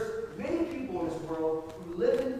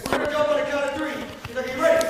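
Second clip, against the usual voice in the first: loud, higher call over engine noise; shouting over wind; 5 semitones higher, 9 dB louder, faster than usual. Retold or imitated. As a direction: yell the countdown to the jumper.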